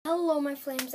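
ordinary delivery

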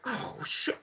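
hushed, caught-out apology; sheepish son. Retold or imitated. Grown son to his mom, hushed and sheepish. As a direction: shrink like a grown man caught cursing.

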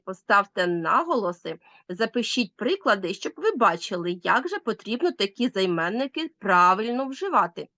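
ordinary talk, nothing marked

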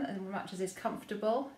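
ordinary talk

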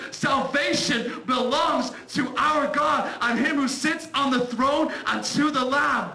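slow, loud proclaiming cry. A narrator's reading. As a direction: cry it out toward the throne. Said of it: "they'll cry out in a loud voice".

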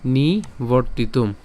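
নিবর্তিতম spelled out slowly; slow syllables. Shown as a demonstration. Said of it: "তাহলে নি". (নিবর্তিতম ধীরে ভেঙে)

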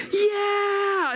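put-on high, drawn-out "yeah" of a thrilled child; mock roller-coaster whoop; thrilled kid's cheer. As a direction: imitate a kid whooping on a coaster.

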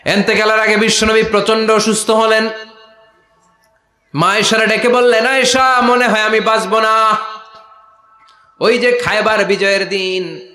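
high, loud sermon delivery; full-force preaching. A narrator's reading. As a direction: proclaim it high over the crowd.

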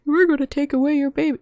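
game nagging player in a hammed-up voice; mock game scolding. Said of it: "the game starts yelling at me and says".

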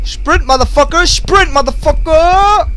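hammed-up desperate command; frantic shout. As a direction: scream at your runner to move. Yelling at his character to run.

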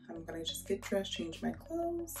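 normal talking voice

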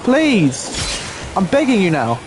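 high-pitched, falling plea; exasperated plea. Real, not acted. Player begging reckless teammates to listen, exasperated plea.

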